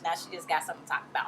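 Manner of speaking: ordinary talk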